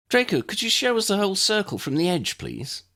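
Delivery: a lightly voiced young woman, high and polite; girl's voice asking a favour; Lydia's voice. A narrator's reading. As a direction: ask it as the girl, politely.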